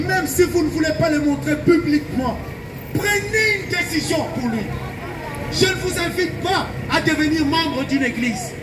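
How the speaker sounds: ordinary delivery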